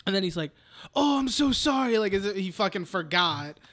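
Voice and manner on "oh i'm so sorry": high-pitched mock apology; gushing apology. Imitating someone who forgot, gushing apology. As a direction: overdo the sympathy, pitched up. Voices apology after forgetting.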